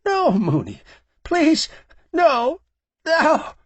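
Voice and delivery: very high, loud crying pleas; sobbing plea. A narrator's reading. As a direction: sob and beg, voice cracking high. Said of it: "Campo began sobbing now".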